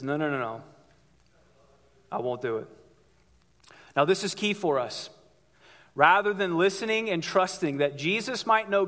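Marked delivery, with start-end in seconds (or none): 0.0-2.7 quieter, lower, dug-in no; stubborn refusal. Retold or imitated. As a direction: refuse flatly under your breath.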